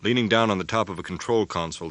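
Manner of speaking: usual speaking voice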